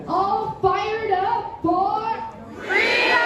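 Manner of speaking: slow, rising call-and-response chant; say it like you mean it. Shown as a demonstration. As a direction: lead the crowd's rallying cry. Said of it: "say it like you mean it".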